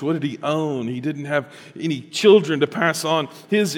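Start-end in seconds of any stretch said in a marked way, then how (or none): none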